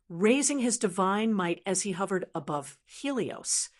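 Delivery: ordinary delivery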